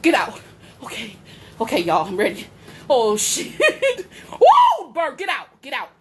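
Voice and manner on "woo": high, loud "woo" shriek; startled whoop. Real, not acted. Woman dodging the bird indoors, startled whoop. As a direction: yelp out a high whoop in alarm.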